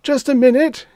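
high, loud panicked shriek. A narrator's reading. Reggie stopping Mister Demise as he closes in, shrieking. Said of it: "he shrieked".

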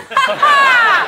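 sudden high burst of laughter; loud laugh. Real, not acted. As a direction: crack up at the cheeky reply. Host laughs at tree's retort.

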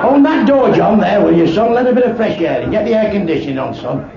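loud shout across the club; calling out. Acted. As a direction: yell the order to the barman. Calling out to staff.

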